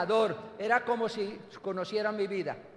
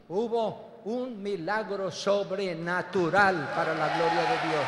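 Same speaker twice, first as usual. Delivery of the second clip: solemn preaching; 4 semitones lower, slower than usual. Real, not acted. Climactic testimony moment.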